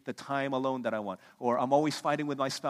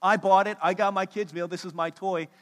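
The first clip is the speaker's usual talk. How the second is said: mock kid yelling; 5 semitones higher, 8 dB louder than usual. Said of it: "So this boy starts yelling at the dad".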